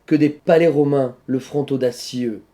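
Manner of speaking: "audacieux" in four syllables, the i sounded apart from eux; diaeresis on audacieux. Shown as a demonstration. Teacher reciting a twelve-syllable line, "audacieux" said au-da-ci-eux with diaeresis. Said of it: "la diérèse ici permet d'avoir les douze syllabes".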